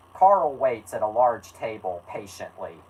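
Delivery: ordinary delivery, nothing marked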